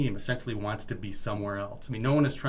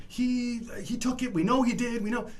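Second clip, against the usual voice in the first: high mock old customers; 11 semitones higher than usual. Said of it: "Like, you know".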